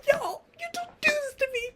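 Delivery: very high, softened squeal; hushed mock fangirl scream; retold fangirl scream. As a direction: squeal it high without full volume. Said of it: "Amber and I were screaming".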